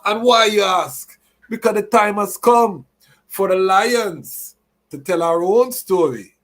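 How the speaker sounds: dramatic announcer build-up. Real, not acted. Building to dramatic show reveal.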